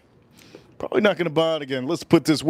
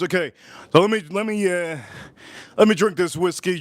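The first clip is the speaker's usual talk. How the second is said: ordinary delivery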